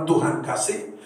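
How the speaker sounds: normal talking voice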